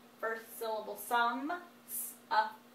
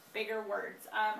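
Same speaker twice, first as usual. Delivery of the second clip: ordinary talk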